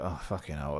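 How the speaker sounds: low, muttered grumble; soft, weary mock grumbling; moaning mum. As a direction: imitate mum moaning under her breath. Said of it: "moaning about something".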